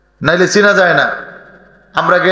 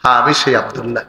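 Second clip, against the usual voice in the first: low, subdued voice of a weeping man; understated tearful murmur; tearful reply; 6 semitones lower, 3 dB quieter, faster than usual. Retold or imitated. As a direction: answer softly and humbly through imagined tears. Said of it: "চোখের পানি ছেড়ে দিয়ে বলি".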